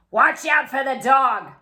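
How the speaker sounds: a loud, urgent warning; burglar warning. A narrator's reading. Burglar warning his partner loudly about the dog. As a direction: call out the warning to your partner. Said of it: "said one of the burglars".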